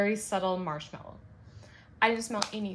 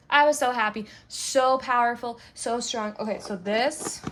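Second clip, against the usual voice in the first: emphatic, louder happy gushing; really excited; 3 semitones higher, 5 dB louder than usual. Real, not acted. Reviewer raving about a strong pine scent, really excited. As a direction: rave about it with rising energy. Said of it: "sorry, I got really excited".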